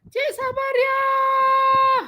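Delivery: high, loud call with a long held ending. Real, not acted. Teacher calling Cik Sabariah, calling out. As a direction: holler the name, stretching it out.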